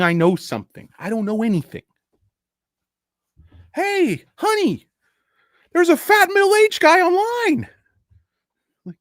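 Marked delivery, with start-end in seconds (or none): 3.7-7.7 very high, loud falsetto wife voice; mock wife. Streamer mimicking a wife telling her husband about him, in falsetto. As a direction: squeal it like a gossiping wife.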